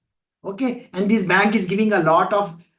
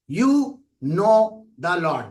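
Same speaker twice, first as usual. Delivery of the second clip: slow; 2 semitones lower, much slower than usual. Retold or imitated. Wake-up call quoted.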